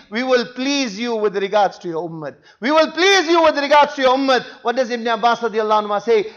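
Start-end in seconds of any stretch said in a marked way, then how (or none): 0.1-2.3 lower, softened consoling promise; gentle reassurance. Acted. Message to the weeping Prophet about his ummah, reassuring. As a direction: console him with a settled, lowered voice.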